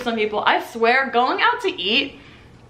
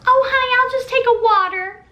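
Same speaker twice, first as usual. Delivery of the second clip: high, sugary voice of the wife; sweet wife; 13 semitones higher, 4 dB louder than usual. Retold or imitated. The wife orders water from the waitress, high and sugary.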